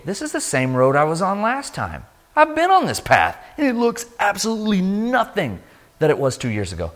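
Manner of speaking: high, climbing amazed recollection; astonished realization. Retold or imitated. Traveler recognizes the once-dead road, astonished. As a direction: let the voice climb as it dawns on you.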